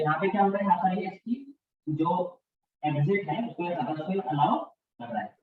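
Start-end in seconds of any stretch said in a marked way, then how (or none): none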